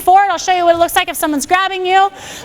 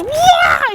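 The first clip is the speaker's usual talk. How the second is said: much higher mock martial arts cry; hammed-up fighting shout; mock kiai; 8 semitones higher than usual. Instructor mocking martial arts moves, playful mock kiai. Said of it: "We do all this".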